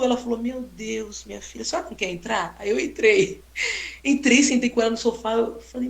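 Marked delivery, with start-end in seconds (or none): 0.4-2.6 put-on soft, quick old-lady welcome; a calm grandmother inviting in; calm gentle invitation. Old lady inviting the photographer inside, soft and calm. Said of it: "E ela é toda calma".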